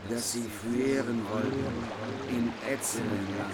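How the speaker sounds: quiet, brisk verse reading; old verse recitation. Acted. Narrator recites the original verse, quietly and quickly.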